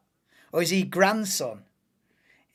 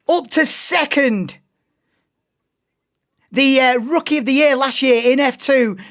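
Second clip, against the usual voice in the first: louder, higher play-by-play call of the start; race commentary; 12 semitones higher, 10 dB louder than usual. Acted. Streamer calling the F2 grid for viewers, excited commentary.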